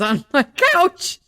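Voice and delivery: hammed-up indignant squeal; a friend outraged at her couch guests, mock high and loud. Retelling friend's outraged reevaluation.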